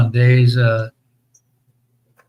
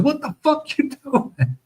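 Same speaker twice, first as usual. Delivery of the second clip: much higher, slow, strained disbelief; shocked Seinfeld squeal; 11 semitones higher, 4 dB quieter, slower than usual. Retold or imitated. Retelling a Seinfeld scene, Jerry's high slow shocked cry. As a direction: squeak it out like a horrified sitcom star. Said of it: "and Seinfeld goes".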